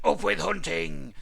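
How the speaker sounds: loud, slow war chant; storybook shout, drawn out. A narrator's reading. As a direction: shout it as a mean dinosaur's slogan. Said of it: "they shouted".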